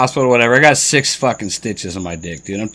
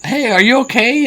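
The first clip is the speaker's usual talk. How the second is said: concerned shopper; 12 semitones higher, 4 dB louder than usual. Retold or imitated. Shoppers voiced in story.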